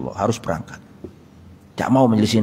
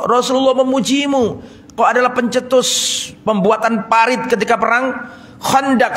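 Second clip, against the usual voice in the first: higher, urging voice of a friend at the sickbed; Sa'ad consoling; 8 semitones higher than usual. Acted. Sa'ad urging his weeping sick friend, higher pleading voice. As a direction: lift him with praise, pleading.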